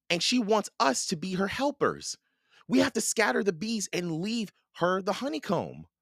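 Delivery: put-on high-pitched little girl explaining the plan; earnest girl Annie. A narrator's reading. Narrator voices Annie.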